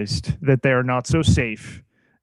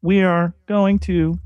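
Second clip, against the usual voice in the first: robot voice; 5 semitones higher than usual. Pretend. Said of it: "can we have the real [name] back now".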